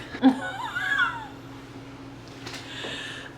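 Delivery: high breathless laugh; giddy laugh. Real, not acted. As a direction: laugh out of pure delight. Giddy over camera.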